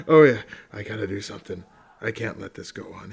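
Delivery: a hint of a determined do-gooder muttering to himself; mock heroic fan again. Redoing the fan's impression, low mock resolve. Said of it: "my impression would have been better".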